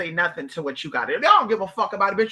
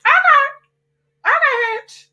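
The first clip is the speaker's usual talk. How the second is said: much higher, louder, each okay a sharp challenge; defiant okay; 8 dB louder, slower than usual. Pretend. Told she can't withdraw, she answers the bank with loud defiant okays. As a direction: fire back okay like a threat.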